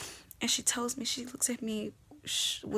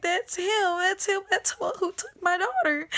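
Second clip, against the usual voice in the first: higher and louder, panicked pointing out; panicked cry; 7 semitones higher, 6 dB louder than usual. Retold or imitated. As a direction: cry out that it's him in panic.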